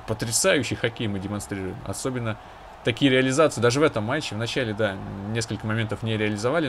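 ordinary delivery

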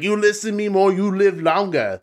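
badly faked Short Round accent; terrible accent. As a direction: put on a clumsy accent, slowing at the end. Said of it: "That's my terrible accent".

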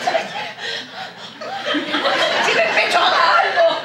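badly faked collapse; faked attack. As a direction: choke the words out melodramatically. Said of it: "te encanta andar fingiendo ataques".

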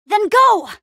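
high, forceful shout; urgent command. Acted. Hero sending a companion into action, urgent shout. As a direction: send them off with a yell.

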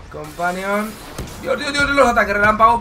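ordinary delivery

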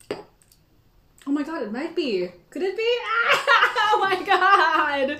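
high, loud, slow gasp of excitement; suddenly thrilled, laughing; excited realization. Real, not acted. Realizing possible answer.

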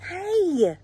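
loud, high protest; crying out. A narrator's reading. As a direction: cry out as raccoon and skunk. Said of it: "cried the raccoon and the skunk".

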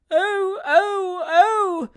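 loud, very high wailing "oh"s; child's screams. A narrator's reading. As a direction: wail like a frightened small boy. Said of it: "screamed the small sufferer again".

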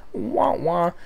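mock wah wah; sad trombone. Mocking own failure with a sad trombone wah wah.

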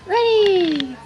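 loud, high triumphant reveal; big reveal. Real, not acted. Showing the viewers the gift, a triumphant shout. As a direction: unveil it with a flourish.

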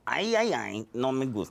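high, hammed-up Mexican accent. Actor quotes his audition line to his agent, hammed-up Mexican accent. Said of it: "Mexican accents".

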